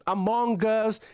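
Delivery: ordinary delivery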